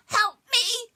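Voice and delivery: panicked little creature; crying for help. Acted. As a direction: cry out as if bursting. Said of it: "she cried".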